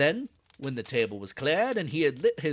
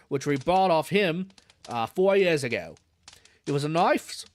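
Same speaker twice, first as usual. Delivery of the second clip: put-on old London zookeeper in a Cockney accent. Zookeeper chatting to a visitor, Cockney accent. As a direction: drop the aitches, Cockney keeper at ease. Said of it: "This guy is such an accent".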